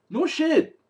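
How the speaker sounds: high-pitched exasperated jab; incredulous, high sarcastic punchline; sarcastic disbelief. Real, not acted. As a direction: pitch it way up, stating the obvious. Sarcastic verdict on obvious answers.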